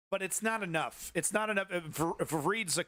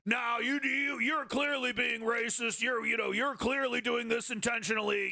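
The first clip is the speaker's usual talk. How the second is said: Jesse Ventura impression; 8 semitones higher than usual. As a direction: call them out flat-out like a brash ex-wrestler. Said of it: "Could you imagine Jesse Ventura on this?".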